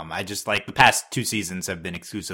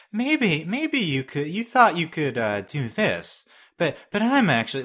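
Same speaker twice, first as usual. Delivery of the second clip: smug villain voice, high; mock smug villain drawl; Cinder impression; 7 semitones higher, slower than usual. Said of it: "she sounds the same".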